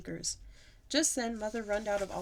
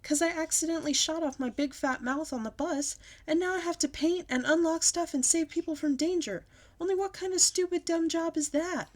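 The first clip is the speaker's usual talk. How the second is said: yelling; 9 semitones higher, 4 dB louder than usual. A narrator's reading. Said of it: "I yelled to Mother".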